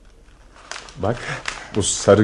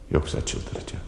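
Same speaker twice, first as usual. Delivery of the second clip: breathy whisper to himself; a secret, unvoiced murmur; whispered aside; 8 dB quieter, slower than usual. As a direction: whisper it so she won't wake. Muttering to himself by the bed.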